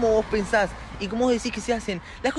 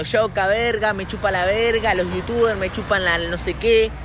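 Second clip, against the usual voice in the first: louder, slow, swaggering mock rapper; 5 dB louder, slower than usual. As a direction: parody him trash-talking. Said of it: "[name] hace un año diciendo".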